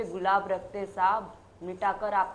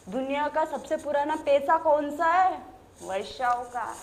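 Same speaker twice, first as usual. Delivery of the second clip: ordinary talk, nothing marked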